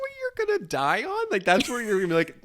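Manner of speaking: very high, incredulous mock challenge; mock outrage. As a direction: squeal it out in exaggerated disbelief. Mock challenge to the film character.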